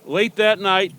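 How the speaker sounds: ordinary delivery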